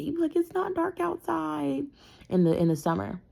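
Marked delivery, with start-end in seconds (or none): 0.0-1.9 high, drawn-out mock child complaint; protesting young son. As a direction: imitate a little boy objecting to bedtime. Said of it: "he used to hate it".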